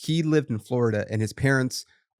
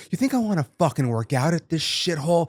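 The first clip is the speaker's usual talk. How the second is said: scornful retort; 5 semitones higher than usual. Retold or imitated. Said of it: "I was like".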